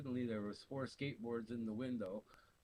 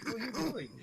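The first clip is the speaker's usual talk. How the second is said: high, loud, disbelieving "what are you doing"; incredulous question; 11 semitones higher, 6 dB louder, much faster than usual. Retold or imitated. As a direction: throw it up high like you can't believe it. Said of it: "it's like".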